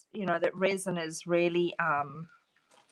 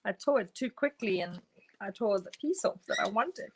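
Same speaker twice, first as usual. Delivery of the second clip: ordinary talk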